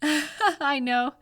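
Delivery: I know through a real laugh. Real, not acted. Laughs at own trolling remark.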